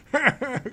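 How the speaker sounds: put-on high cackling old man laugh. Old elf laughing at being called son. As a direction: cackle like an amused elder. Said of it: "laughs at this".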